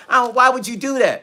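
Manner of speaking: mock an upset wife's objection. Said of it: "She was upset".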